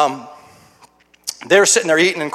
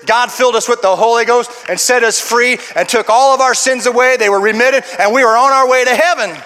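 raised, emphatic testimony building in force; forceful preaching; 7 semitones higher, 4 dB louder than usual. Real, not acted. Preacher testifies to being saved and set free, forceful and emphatic. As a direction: drive each statement home to the congregation.

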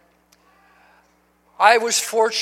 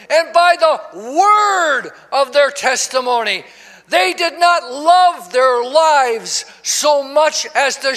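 slow, emphatic pulpit declamation; preached scripture; 4 semitones higher, 4 dB louder, slower than usual. Acted. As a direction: land hard on the key words.

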